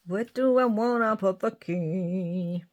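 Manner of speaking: slow, drawn-out northern musing; put-on northern accent, stretching the words. Shown as a demonstration. Crafter wondering where to place a key, slow northern accent. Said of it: "when I'm doing something like this".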